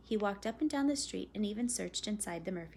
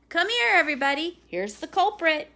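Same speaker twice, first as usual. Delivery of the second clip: louder, faster call to the whole house; amused call; 7 semitones higher, 11 dB louder than usual. Acted. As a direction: call everyone to the door, laughing. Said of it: "and he called".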